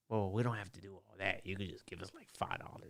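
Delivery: sly hushed bribe. Retold or imitated. Corrupt cops asking for five dollars, hushed and low. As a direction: murmur it like a quiet shakedown.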